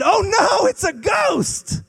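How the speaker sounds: high, loud alarmed shout at a ghost; frightened cry. Retold or imitated. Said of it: "They said".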